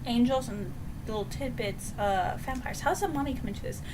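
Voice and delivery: imitated puzzled viewer. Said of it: "And you're like".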